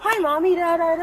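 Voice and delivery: put-on high-pitched little-kid greeting; imitated small child. Mother quoting her son's hello, high child voice. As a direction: lift it high, like a child chattering to mom.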